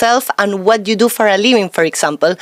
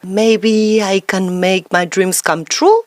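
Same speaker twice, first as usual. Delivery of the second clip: hesitant, rising to a question on "true"; self-talk with an upward, uncertain ending; doubtful; 4 semitones lower than usual. Shown as a demonstration. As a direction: say it as if unsure, lifting "true" upward. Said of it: "with such doubt".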